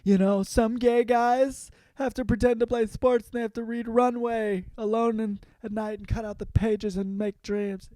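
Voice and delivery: lightly camp, wistful Tucci impression; imitating Stanley Tucci. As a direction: gush it like a dreamy stylist. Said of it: "Stanley Tucci's like".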